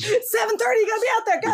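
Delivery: high mock shout; pretend stage manager. Pretending to send the singer on, high mock-urgent shout. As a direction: shout a performer out on stage, playfully.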